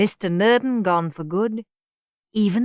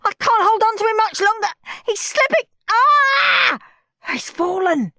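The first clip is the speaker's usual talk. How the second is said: high, loud faked desperation; mock panic; 7 dB louder than usual. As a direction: fake a frantic struggle, up high. Henry fakes a desperate rescue.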